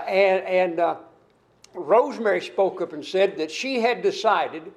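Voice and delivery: ordinary talk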